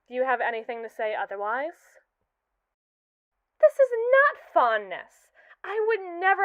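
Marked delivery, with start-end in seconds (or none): 3.6-6.4 indignant denial. Acted. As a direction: snap back defensively, word by word. AI denies having feelings.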